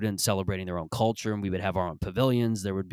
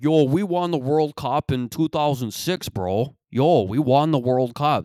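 loud, slow bragging "yo, bro" voice; cocky Italian kid; 4 semitones higher, 7 dB louder, slower than usual. Retold or imitated. Mimicking Italian kids bragging about the World Cup, loud and slow. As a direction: puff up and boast, dragging it out. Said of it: "The Italian kids would be like".

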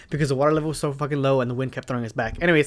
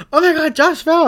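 high, louder alarmed cry from the sister; friend's panicked cry; 12 semitones higher, 5 dB louder than usual. Retold or imitated. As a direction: shriek that her friend fell in the river. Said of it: "She's like".

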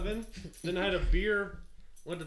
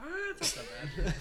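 laughing; 12 semitones higher, 3 dB quieter than usual. Real, not acted. Laughing at own tongue-twister fail.

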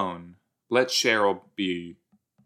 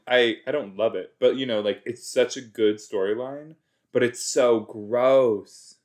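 ordinary talk

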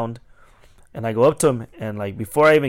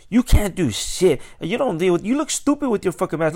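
high, loud mock rant; retold angry yelling; 7 semitones higher, 5 dB louder than usual. Customer yelling back when told he must leave, mock rant. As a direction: imitate a defiant customer shouting insults. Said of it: "So he starts yelling".

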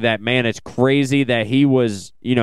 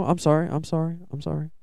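sorry repeated softer and softer; fading apology; 2 semitones higher, 7 dB quieter, faster than usual. Real, not acted. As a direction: trail off into quieter apologies. Fading repeated apology after correction.

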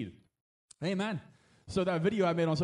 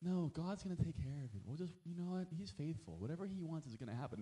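much softer, mocking naive faith; mock naive believer; 13 dB quieter than usual. Preacher mocking passive Christians, soft mock voice. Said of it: "it's like".

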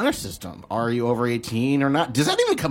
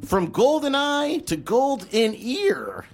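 hammy sponsor announcer, climbing high; mock sponsor read; 6 semitones higher than usual. Host reading fake celebrity sponsors, hammed-up announcer. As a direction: sell each pun, stretching it out at the end.

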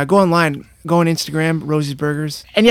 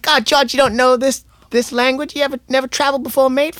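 higher, lightly Australian teasing; Aussie mate; 10 semitones higher than usual. Retold or imitated. Australian husband mocking George for never travelling, higher pitch. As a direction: tease him like a cocky Aussie. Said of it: "He's like".

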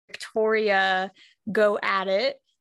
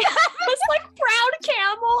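laughing; 13 semitones higher, 5 dB louder than usual. Retold or imitated. Mocking the silly animal name.

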